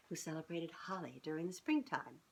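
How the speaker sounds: usual speaking voice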